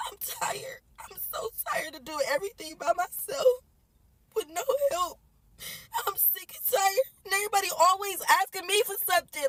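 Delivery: crying through the words, exhausted. Real, not acted. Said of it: "My kids see me crying".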